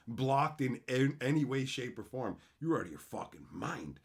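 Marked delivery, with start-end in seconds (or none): none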